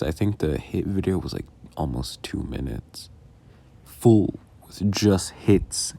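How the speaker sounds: ordinary talk, nothing marked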